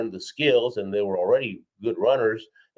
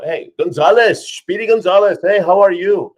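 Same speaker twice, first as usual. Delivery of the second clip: imitated hearty greeting; 9 semitones higher, 10 dB louder than usual. German lugers finally accepting the newcomer, imitated in loud, high, hearty calls. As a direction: call out like suddenly chummy rivals. Rivals suddenly greeting him warmly.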